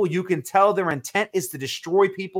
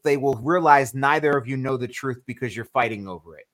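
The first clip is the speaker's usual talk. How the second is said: ordinary talk, nothing marked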